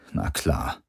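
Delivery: low, soft cynical aside. A narrator's reading. Cynical inner voice mocking the prisoner's hopes, low and dismissive. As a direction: drop it under your breath, cynically. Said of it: "sagte eine zynische Stimme in seinem Kopf".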